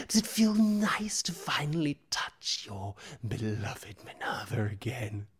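eerie whispering creature with laughs; creepy whisper. Acted. GM voices the scarred figure.

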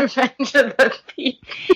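laughing title. Pretend. Echoes the mock title.

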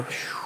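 soft sigh of relief; unvoiced breath of relief; relieved exhale. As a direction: let out a quiet phew. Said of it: "I'd go".

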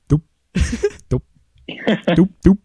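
popping doop doop fish noises; fish impression. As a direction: pop your lips like a fish. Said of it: "so you do your best fish impression".

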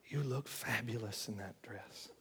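devil whispering. Retold or imitated. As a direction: purr it like a tempting devil. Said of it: "I can hear the devil whispering in my ear".

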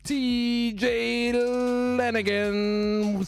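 very slow, drawn-out name; dramatic drawn-out read. Acted. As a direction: stretch it out like an announcer. Host stretches a name dramatically.

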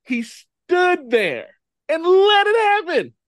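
high, loud, drawn-out exasperation; exasperated disbelief. Real, not acted. Ranting about the dumbest movie death, exasperated disbelief. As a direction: stretch it out, voice climbing in disbelief.

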